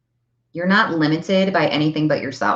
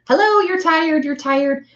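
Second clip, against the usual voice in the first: much higher, sing-song taunting voice; mocking inner nag; 11 semitones higher than usual. Mind nagging you mockingly.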